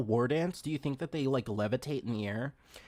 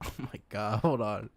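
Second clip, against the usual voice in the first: ordinary delivery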